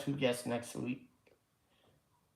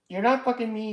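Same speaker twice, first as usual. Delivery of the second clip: emphatic retort; 8 semitones higher, 6 dB louder, faster than usual. Real, not acted.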